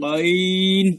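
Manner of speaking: গইন drawn out from the end of the throat; deep-throat ghain. Shown as a demonstration. Teacher showing where it comes from, throaty ghain. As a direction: hold গইন from far back in the throat. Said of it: "কণ্ঠনালীর শেষ অংশ থেকে আসবে".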